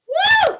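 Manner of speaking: high, loud joyful woo; a sudden whoop of delight; excited whoop. Real, not acted. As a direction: let out a whoop of joy. Excited cheer on stream.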